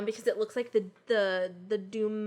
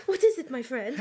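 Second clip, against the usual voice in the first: bad French accent; 3 dB louder than usual. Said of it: "With the worst French accent ever".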